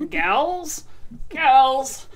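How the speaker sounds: high cartoon explorer impression; playful put-on catchphrase voice; Nigel Thornberry. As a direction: call it out high, as a cartoon character. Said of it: "like Nigel Thornberry".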